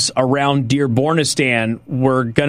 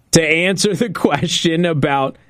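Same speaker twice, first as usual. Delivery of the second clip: ordinary talk, nothing marked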